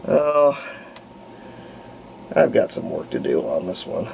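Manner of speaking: ordinary talk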